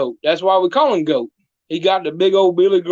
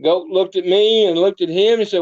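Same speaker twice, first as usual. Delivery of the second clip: usual speaking voice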